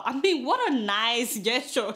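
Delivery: ordinary talk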